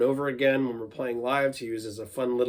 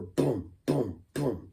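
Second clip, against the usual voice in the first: boom bass beat; 4 dB quieter, much slower than usual. Shown as a demonstration. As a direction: hum a short boom kick. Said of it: "to get that bass sound".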